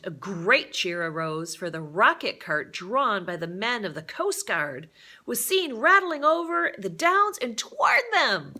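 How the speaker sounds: rising to a thrilled, loud climax; building excitement. A narrator's reading. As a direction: swell with excitement as the cart arrives. Rescue cart arrives, rising excitement.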